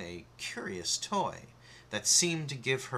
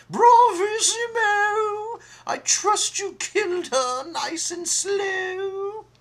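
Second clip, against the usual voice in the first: far higher, much louder, slow triumphant cry; gleeful shriek; 11 dB louder, slower than usual. Acted. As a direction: crow with cruel delight. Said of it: "The Queen cried out".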